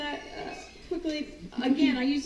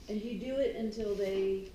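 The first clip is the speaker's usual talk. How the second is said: ordinary talk, nothing marked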